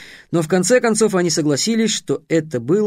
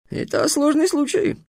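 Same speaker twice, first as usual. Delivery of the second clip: sighing; 12 semitones higher than usual. A narrator's reading. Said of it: "со вздохом согласился Китап".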